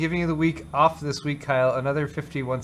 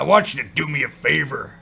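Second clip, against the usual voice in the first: deep pitch shifted. Pretend. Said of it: "Whose voice is it?".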